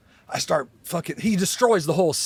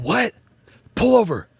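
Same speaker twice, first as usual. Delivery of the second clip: higher, louder urgent call; higher, louder sudden command; urgent demand; 7 semitones higher, 7 dB louder than usual. Retold or imitated. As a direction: bark at the driver to stop. Retelling the shout at his friend.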